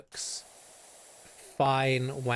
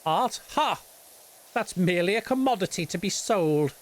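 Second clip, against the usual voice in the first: high, scoffing mock artist; haughty Anish Kapoor parody; 5 semitones higher than usual. Streamer mocking artist who sees art as commodity, haughty scoff. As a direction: scoff grandly in a higher put-on voice. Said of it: "that's the impression he's given".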